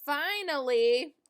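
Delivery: plain reading voice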